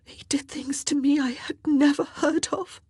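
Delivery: high, breathy crying voice; ashamed, sobbing half-whisper; tearful whisper. A narrator's reading. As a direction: whisper it through tears. Mary weeping, confessing her ordeal.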